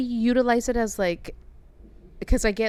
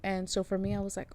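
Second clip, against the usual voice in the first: ordinary talk, nothing marked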